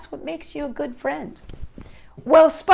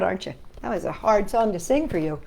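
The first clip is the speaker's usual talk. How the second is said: plain reading voice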